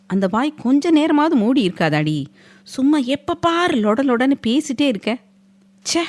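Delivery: irritated husband snapping at chatty wife; exasperated. A narrator's reading. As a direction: sigh it out, sick of her chatter. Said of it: "என்று சலித்து கொண்டான்".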